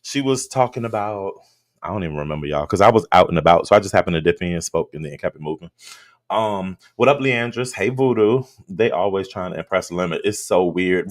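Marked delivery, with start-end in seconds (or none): none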